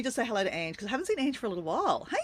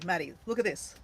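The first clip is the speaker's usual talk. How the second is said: ordinary talk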